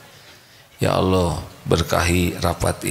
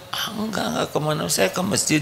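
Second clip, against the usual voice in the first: higher, softer, sheepish fib; nervous excuse; 13 semitones higher, 3 dB quieter than usual. Acted. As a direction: stammer out a guilty excuse. Husband fibs nervously to wife. (suami berbohong dengan gugup)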